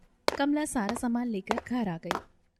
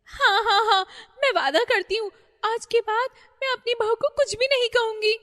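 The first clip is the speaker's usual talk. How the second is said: loud, much higher begging with a sob; tearful pleading; 14 semitones higher, 9 dB louder than usual. Acted. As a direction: promise anything, frightened and crying. Terrified mother-in-law begs spirit.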